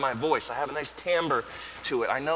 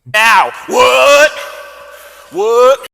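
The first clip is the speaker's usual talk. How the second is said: loud, high startled what; sudden shocked outburst; startled shout; 8 semitones higher, 13 dB louder, much slower than usual. Acted. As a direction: yell it as if interrupted mid-speech.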